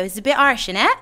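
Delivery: clipped-together Bristolian "isn't it", lifting at the end; Bristolian accent. Shown as a demonstration. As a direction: run "It's a bit harsh" together, Bristolian style. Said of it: "how we say it in Bristol is we go".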